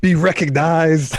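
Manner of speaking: slow, high forceful whisper-shout; whisper yelling. Pretend. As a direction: hiss it out like a loud secret. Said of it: "Why are you whisper yelling at me?".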